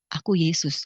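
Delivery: low, calm divine voice; Yesus. Retold or imitated. Revealing himself to the woman, low and calm. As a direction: answer low and unhurried.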